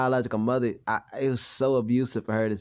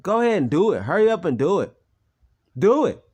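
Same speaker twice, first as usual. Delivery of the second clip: higher, louder angry shout; angry retort; 6 semitones higher, 5 dB louder than usual. Retold or imitated. Son shouts back at mother angrily. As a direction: yell it back at her defiantly. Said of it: "I was like".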